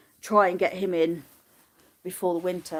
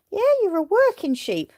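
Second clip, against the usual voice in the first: high cooing voice; pet talk; 11 semitones higher, 3 dB louder than usual. Real, not acted. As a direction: praise the sheep sweetly. Talking to the sheep.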